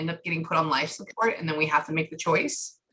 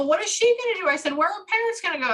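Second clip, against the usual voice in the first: high eager question; 14 semitones higher, 4 dB louder, faster than usual. Retold or imitated.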